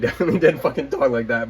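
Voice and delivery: ordinary delivery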